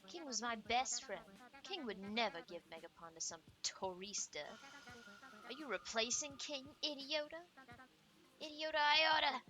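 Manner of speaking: acted offended outburst; snapping at someone. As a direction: snap in hurt outrage, building to an outburst. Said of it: "sorry for snapping".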